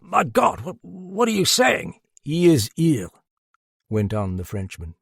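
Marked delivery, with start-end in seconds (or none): 0.0-2.0 high, loud, stammered shock; agitated high-pitched alarm; horrified disbelief. A narrator's reading. Sir John horrified by the announcement. 2.2-3.1 raised, louder declaration; emphatic high announcement; emphatic insistence. A narrator's reading. Detective insists the killer is present.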